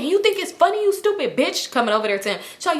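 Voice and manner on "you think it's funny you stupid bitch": high-pitched mock outrage; high, indignant impression of a friend; retold tearful anger. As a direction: imitate an upset friend lashing out, pitched up. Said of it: "and she's crying".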